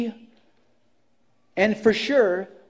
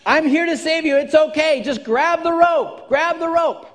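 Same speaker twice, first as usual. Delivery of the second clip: high, loud, pleading hail; calling down; 8 semitones higher, 4 dB louder than usual. Retold or imitated. Captain calling to the third person, high and loud. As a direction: urge him to grab the rope, high and loud. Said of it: "And he's saying".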